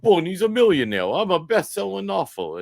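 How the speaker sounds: hammed-up gloating Bernie; gloating mock Bernie. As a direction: crow about it like a newly rich man. Mocking Bernie's sudden wealth.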